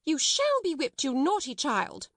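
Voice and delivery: high, indignant scolding; severe threat. A narrator's reading. Miss Minchin threatening naughty Lottie, angrily proclaiming. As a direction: scold sternly, pitch starting high. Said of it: "Miss Minchin proclaimed".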